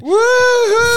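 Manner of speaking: high, loud "woo-hoo" cheer; excited whoop. Real, not acted. Co-host cheering the mate stepping in for predictions, excited. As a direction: let out a big high-pitched whoop.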